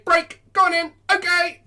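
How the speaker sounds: loud, very high-pitched shout to the dog; over-excited release command. Shown as a demonstration. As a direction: hype the dog up, as a bad example. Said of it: "Then don't say".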